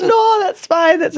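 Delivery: high-pitched mock-Irish reassurance through laughter; badly faked Irish lilt, very high; horrible Irish accent. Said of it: "it was horrible accent".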